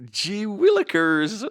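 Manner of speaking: very high, loud, slow squeal of delight; excited squeal. Retold or imitated. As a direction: squeal it like a giddy boy. Excited inner thought, very high.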